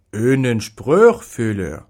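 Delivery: earnest gentleman explaining; lightly voiced serious man; serious pet owner. A narrator's reading. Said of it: "entgegnete dieser ernst".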